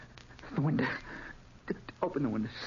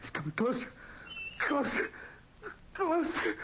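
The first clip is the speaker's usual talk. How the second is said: each closer higher in terror; rising panic; 13 semitones higher than usual. Acted. Trapped man watching the canopy descend, rising terror. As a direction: climb the pitch with each word.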